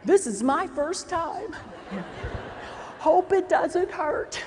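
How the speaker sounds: slow, hushed nervous patient-student voice; a touch of timid student, slowed right down. Retold or imitated. Student reassuring the instructor, slow and hushed. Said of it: "saying things like".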